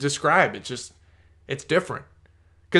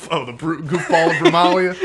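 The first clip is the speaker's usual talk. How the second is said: louder, hammed-up show voice with laughter; impression of old voice; 2 semitones higher, 5 dB louder than usual. Shown as a demonstration. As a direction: put on your old show voice, breaking into laughs. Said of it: "I can do an impression of it".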